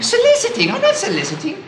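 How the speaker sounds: high-pitched, affronted echo; indignant protest. Acted. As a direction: squawk at the accusation, then deny it. Accused of soliciting, protests.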